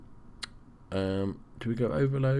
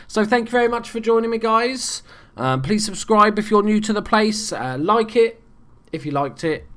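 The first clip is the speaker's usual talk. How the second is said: upbeat sign-off; 10 semitones higher, 5 dB louder than usual. Real, not acted. Cheery outro to viewers.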